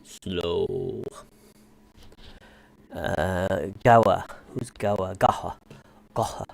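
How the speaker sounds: deep, dragging slowed-down filter; slow low voice changer. Real, not acted. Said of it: "I'm talking like this".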